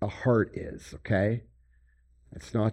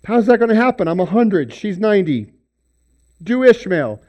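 higher, louder, a hint of scoffing doubt; incredulous Abraham; 7 semitones higher, 8 dB louder, faster than usual. Abraham questioning God about having a child. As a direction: scoff at the promise. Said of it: "he says".

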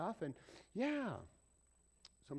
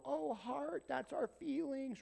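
higher, slower naive voice; naive assumption; 9 semitones higher, slower than usual. Retold or imitated. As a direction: mimic the shallow reading.

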